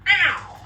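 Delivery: high, loud mock yelp of pain; sharp exaggerated ow cry; imitated ow yelp. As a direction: yelp it like the famous sound effect. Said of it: "You know that sound?".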